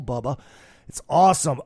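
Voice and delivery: ordinary delivery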